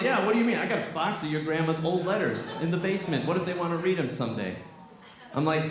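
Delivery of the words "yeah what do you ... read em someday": high-pitched mock protest; exasperated, higher-pitched fatherly objection; indignant dad. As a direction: imitate a dad taking offense.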